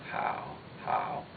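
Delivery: "How" in a low, creaky Mandarin third tone. Shown as a demonstration. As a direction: say "How" deep and soft, as a third tone. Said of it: "which again is the third tone".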